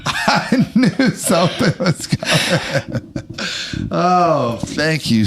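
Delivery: slow words through laughter; laughing. Real, not acted. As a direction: laugh while talking. Laughing at punchline.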